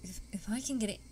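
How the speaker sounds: ordinary talk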